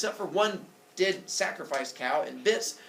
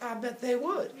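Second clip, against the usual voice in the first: put-on high little-kid voice; high-pitched baby salesman; sly baby Hermes; 7 semitones higher than usual. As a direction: answer like a scheming toddler. Baby Hermes baits Apollo.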